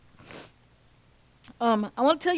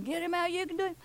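mock yell; 6 semitones higher, 9 dB quieter, much faster than usual. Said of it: "They're yelling".

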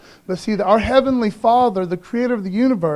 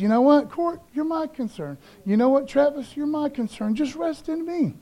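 tender voice of God; 7 semitones higher, 3 dB quieter than usual. Acted. God voiced tenderly, high soft.

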